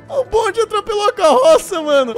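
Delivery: loud, high laughing yells; shrieking laughter. Real, not acted. Gamer watching a tram smash a cart, shrieking with laughter. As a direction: howl at the crash.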